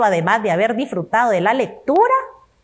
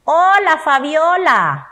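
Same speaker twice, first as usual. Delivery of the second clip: loud, high "Hola", then a slow "Fabiola"; exclaimed greeting; 5 semitones higher, 4 dB louder, slower than usual. Shown as a demonstration.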